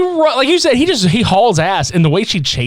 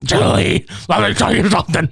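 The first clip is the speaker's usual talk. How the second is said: whispered raspy start, fast comic warning; Fire Marshal Bill. Retold or imitated. Impression of the burned character from the comedy show, whispered then fast. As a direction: lean in and spit out the warning fast. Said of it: "looks like Fire Marshal Bill".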